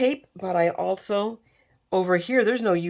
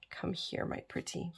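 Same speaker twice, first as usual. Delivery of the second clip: breathy witchy whisper to the glue; playful whisper; 12 dB quieter than usual. Pretend. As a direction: coax it like a wicked witch, whispering. Crafter coos to the glue.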